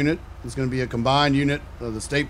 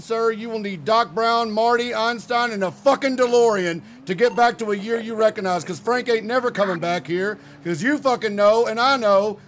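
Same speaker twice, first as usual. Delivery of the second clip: higher-pitched, hammed-up intimidating detective; tough cop threat; 9 semitones higher than usual. Detective pressuring a suspect about a stolen car, hammed-up threat. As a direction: lean on the suspect hard and mock-menacing.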